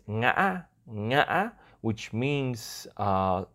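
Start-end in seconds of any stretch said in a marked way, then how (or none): 0.1-1.5 "ngã" broken in the middle, then rising. Shown as a demonstration. Host naming the fifth tone, "ngã" cut and rising. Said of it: "the name of the tone is".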